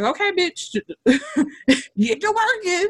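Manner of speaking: high, laughing mock boss; high mock bossy voice. Imitating her new job rushing her, high and laughing. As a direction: play the pushy new employer, cracking up. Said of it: "they was like".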